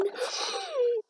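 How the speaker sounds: high sharp gasp of delight; high gasp of surprise. Real, not acted. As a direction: gasp at the reveal. New horse revealed.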